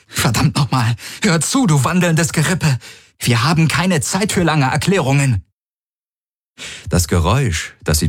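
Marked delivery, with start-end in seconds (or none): 0.1-5.4 high, sharp exasperated scolding; exasperated anger. Acted. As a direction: snap at the walking skeleton. Heroine curses at skeleton.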